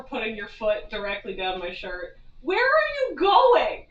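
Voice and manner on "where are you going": high, slow pet voice; pet talk. Real, not acted. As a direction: coo slowly at the wandering cat.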